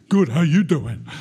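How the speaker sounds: booming, cheerful answer thrown back; match his energy with a big hello; loud routine reply. Retold or imitated. Answering the brother's routine greeting with a loud reply. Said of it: "And I'd say".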